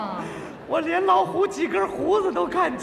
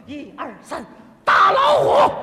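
slogan shout; 7 dB louder, slower than usual. Retold or imitated. Young man leads the crowd, shouting the slogan. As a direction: yell the slogan at the tiger. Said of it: "我起头啊".

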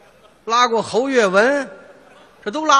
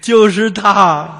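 put-on very slow, louder old man pointing out the culprit; slow accusing old man; 5 dB louder, much slower than usual. Waking old man accusing the driver to his son, very slow. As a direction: drag out each word like a dazed grandpa.

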